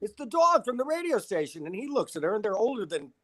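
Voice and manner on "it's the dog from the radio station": high, eager calls to her husband; a thrilled fan calling Morty over; excited calling out. Retold or imitated. Retelling the fan woman calling her husband in excitement.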